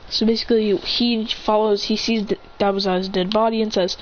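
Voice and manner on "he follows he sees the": ordinary delivery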